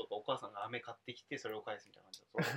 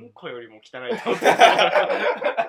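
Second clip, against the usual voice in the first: loud, slow, laughing disgust; 4 semitones higher, 17 dB louder, slower than usual. Real, not acted. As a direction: blurt the disgust out through laughter.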